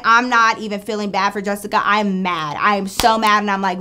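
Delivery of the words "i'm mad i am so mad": ordinary talk, nothing marked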